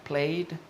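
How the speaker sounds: "played" as one syllable with a final D; D ending. Shown as a demonstration. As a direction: demonstrate "played" with no E sounded. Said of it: "there's no E".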